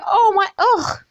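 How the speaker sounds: high disgusted groan; high put-on disgust; mock disgust. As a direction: recoil in exaggerated disgust. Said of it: "you would just go".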